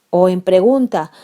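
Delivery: normal talking voice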